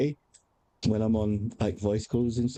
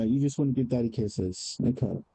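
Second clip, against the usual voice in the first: ordinary talk, nothing marked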